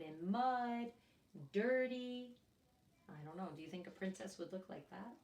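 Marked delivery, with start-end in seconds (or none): none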